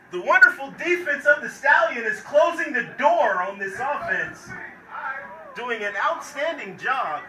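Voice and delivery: loud hype. Real, not acted. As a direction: boom it over the crowd. Defense stops offense, hyped call.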